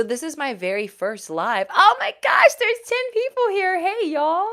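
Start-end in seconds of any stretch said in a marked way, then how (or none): 1.7-4.5 very high, loud thrilled cry; excited squeal. Real, not acted. Host sees ten viewers, squeals with joy. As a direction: squeal with delight at the crowd.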